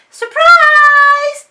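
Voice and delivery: high, loud squeal of surprise; delighted loud cry of surprise; excited cry. A narrator's reading. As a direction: burst out with a shout. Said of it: "cried Dylan".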